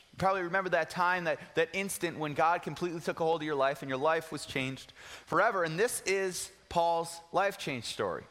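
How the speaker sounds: ordinary delivery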